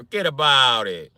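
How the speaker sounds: dismissive Italian-American wiseguy; Italian accent. Acted. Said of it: "The Italian accent".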